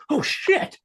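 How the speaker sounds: high startled exclamation; imitating a startled cry; imitated panic. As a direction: echo the film's fright. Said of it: "he just goes".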